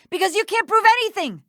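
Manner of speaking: high-pitched, defiant taunt. Acted. Cornered culprit challenges her accusers, defiant.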